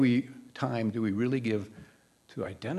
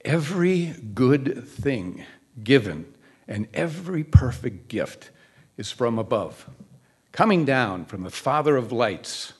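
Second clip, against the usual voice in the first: slow and louder, savoring each word of scripture; loving verse reading; 5 dB louder than usual. Acted. Preacher to his congregation, reciting a favorite verse with fervor. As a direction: proclaim it like a treasured verse. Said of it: "I love this verse".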